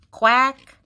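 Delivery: duck quack. A narrator's reading. Duck revealed under the tutu costume, loud quack. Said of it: "says the duck".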